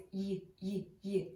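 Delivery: "y" as a short voiced glide, repeated; voiced Y sound. Shown as a demonstration. Teacher showing what the letter Y sounds like, isolated "y". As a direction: isolate the consonant sound "y", not the letter's name. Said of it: "and it is voiced".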